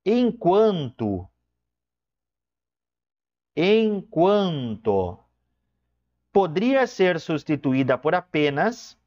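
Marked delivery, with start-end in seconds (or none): 0.0-5.2 enquanto stretched out slowly; slow emphasis. Shown as a demonstration. Teacher giving the meaning of mientras, stretched slowly. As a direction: drag out enquanto to make it sink in. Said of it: "A palavra mientras significa".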